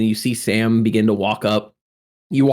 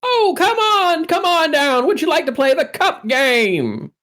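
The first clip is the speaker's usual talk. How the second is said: carnival barker. Acted. Game master as barker inviting Sam to the cup game, high and coaxing. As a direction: lure the player in like a showman.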